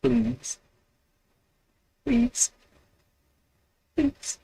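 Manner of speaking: high-pitched pleading please; mock begging squeak. Real, not acted. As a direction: whine it up high like a beggar. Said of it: "Give me a question".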